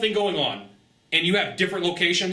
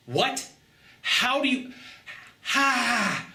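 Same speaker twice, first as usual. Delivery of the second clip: baffled; 8 semitones higher, much slower than usual. Retold or imitated. Dumbfounded by the manager.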